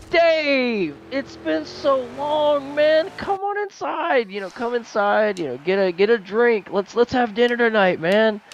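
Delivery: very high, slow, gushing greeting; an old friend overjoyed at the door; excited Tom Cruise. Retold or imitated. Tom Cruise greets Dave at the door, very high and gushing. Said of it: "Tom Cruise kicks the door open. He's like".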